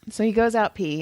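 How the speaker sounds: usual speaking voice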